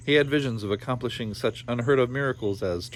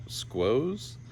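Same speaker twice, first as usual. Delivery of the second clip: a quizzical, quieter repeat of squoze; puzzled aside; 4 dB quieter than usual. A narrator's reading. Reader doubting the word squoze, quizzical. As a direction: question the odd word.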